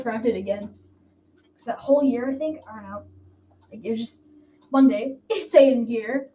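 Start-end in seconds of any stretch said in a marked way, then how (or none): 5.3-6.3 louder, slow mock YouTuber greeting; YouTuber intro. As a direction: ham up a channel intro. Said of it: "I'm starting to sound like a YouTuber".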